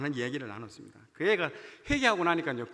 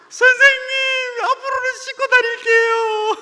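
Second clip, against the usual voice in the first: much higher, slow wailing plea; imitating a sobbing kid; 12 dB louder, slower than usual. Student sobbing to his teacher, promising to wash, high wailing. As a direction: wail it like a crying boy, drawn out. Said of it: "대성통곡하는 거예요".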